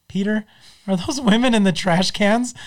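a hint of an accusing wife; mild mock suspicion; quoting Lois. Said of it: "Lois walks up and goes".

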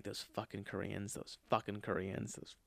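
quiet seething mutter; muttered curse. Retold or imitated. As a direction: grumble it to yourself. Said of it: "now I'm just like".

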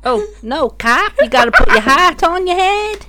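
put-on Australian accent. Boyfriend riffing on no cap when prompted, Australian accent. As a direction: play a mock Australian.

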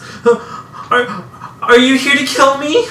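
put-on high, frightened child's voice; terrified girl backing away; scared little girl. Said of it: "presses herself against it and says".

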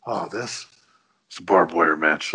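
low, understated tough-guy imitation; deep, unimpressed shrug of an answer; Mickey Rourke. Actor answering about his arm in the strip club, deep deadpan. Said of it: "Mickey Rourke says it exactly like that".

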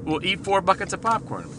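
high-pitched excited suggestion; imitated eager kid. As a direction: mimic a kid proposing a big treat. Mimics the son's big plan.

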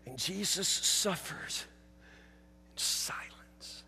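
slow, breathy hush. Real, not acted. Preacher hushing the congregation at the darkness, whispered. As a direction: let it sink to a whisper.

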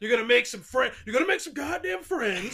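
playful imitation of a barking father; tough dad voice. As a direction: order him to make friends, hammed up.

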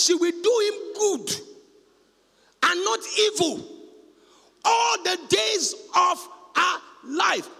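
slow, high, loud declaration; preached verse. Acted. Preacher reading verse twelve aloud, slow loud proclamation. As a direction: proclaim it to the hall.

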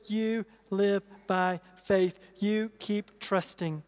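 soft, slow reassuring voice of God; calmly steady the worried believer; gentle reassurance as God. Acted. Voicing God's gentle answer.

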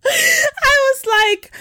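squeaky laugh while talking; words squeezed out through a laugh. Real, not acted.

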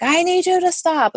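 replaying a desperate order to a child; mock yell of a scared parent on a mountain; retold yell at son. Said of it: "I'm yelling at him".

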